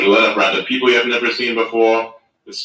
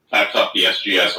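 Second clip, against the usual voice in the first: usual speaking voice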